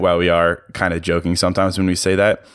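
normal talking voice

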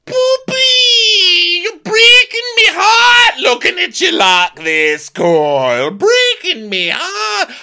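high, loud, mock-crying plea; hammed-up Dorit. Dorit pleading with Kyle, mock sobbing and loud. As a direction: wail it like a dramatic friend, slowly.

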